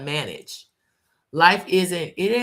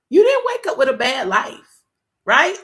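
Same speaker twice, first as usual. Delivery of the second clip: higher and louder, pressing the point; emphatic call; 8 semitones higher, 7 dB louder than usual. Real, not acted. Host pushes listeners with a loud challenge. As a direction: lift it up and hammer it home. Preacher driving home his point.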